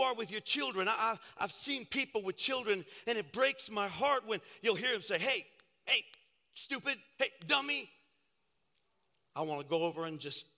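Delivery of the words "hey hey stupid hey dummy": mildly acted, higher-pitched berating; imitating a harsh parent, in short bursts; scolding parent. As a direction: snap it lightly at a child. Voices parents berating their children.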